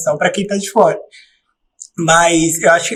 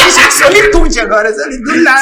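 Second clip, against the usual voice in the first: mocking; 6 semitones higher, 3 dB louder than usual. Real, not acted. Mocking friend's sudden solitude.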